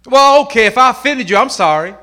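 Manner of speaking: a hint of a grudging, loud fake sorry; mock half-apology. Preacher mocking a grudging apology, half-hearted. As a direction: toss off a grudging non-apology. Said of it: "If I offended you, I'm crying".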